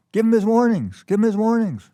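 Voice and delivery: loud, high retold yell; imitated screaming. Law clerk shouts at a guard tackling a thief, imitated screaming. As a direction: yell it out twice like an urgent protest, imitating. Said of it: "he's screaming with his arms".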